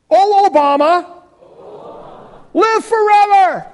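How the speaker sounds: high, loud hammed-up proclamation; mock royal salute. Shown as a demonstration. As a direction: hail him like an ancient king. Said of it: "Let's say this together".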